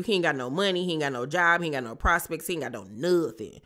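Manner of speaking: ordinary delivery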